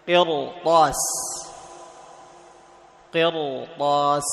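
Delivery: قرطاس with a full, thick emphatic ra; teacher drawing out قرطاس with the ra heavy; heavy r in qirtas. Shown as a demonstration. Teacher models a heavy ra for students. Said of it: "هنا تفخم".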